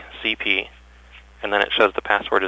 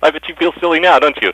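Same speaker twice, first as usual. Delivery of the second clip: mocking taunt; 6 semitones higher, 5 dB louder than usual. Prank caller gloats at clerk.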